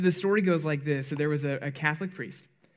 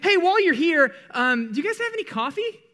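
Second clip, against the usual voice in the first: high, sing-song faux-polite ask; cheeky high mock-innocent request; 13 semitones higher, 6 dB louder than usual. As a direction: play it innocent while taking advantage. Asks nurse for coffee too.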